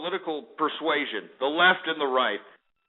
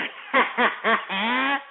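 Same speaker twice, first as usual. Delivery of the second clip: high staccato cackle; hammed-up high chuckle; goofy laugh; 4 semitones higher than usual. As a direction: laugh in rapid bursts at your own crude joke. Laughing at own in-character joke.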